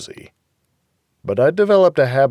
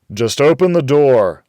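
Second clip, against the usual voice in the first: louder, insistent; firm demand; 5 semitones higher, 8 dB louder than usual. A narrator's reading. Narrator demanding through the door, firm and louder. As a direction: make her open up.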